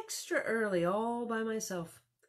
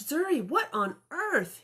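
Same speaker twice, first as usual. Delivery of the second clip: startled dad; 9 semitones higher, 5 dB louder than usual. Acted. Daddy startled by the crash.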